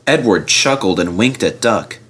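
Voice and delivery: usual speaking voice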